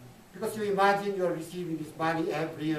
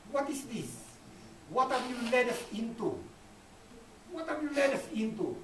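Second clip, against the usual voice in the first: higher-pitched voicing of troubled people's questions; raised-pitch retold questioning; questioning the leaders; 7 semitones higher than usual. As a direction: quote the people's challenge, pitched up.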